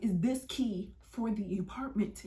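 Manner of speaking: slow, deliberate questioning; imitated officer pressing slowly; pointed repeat. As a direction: spell it out slowly, word by word.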